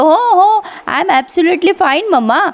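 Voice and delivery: high, slow and beaming, a mock happy laugh in the voice; playfully imitating a son laughing off his mother's worry; laughing reply. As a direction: retell him grinning, drawn out and pitched well up. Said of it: "आता हा मस्त हसून म्हणतो".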